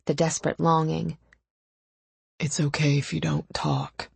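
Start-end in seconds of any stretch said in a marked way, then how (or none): 2.4-4.1 understated gruff male voice; lightly gruff and restrained. A narrator's reading. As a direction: say it roughly, holding feeling back. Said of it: "he said gruffly".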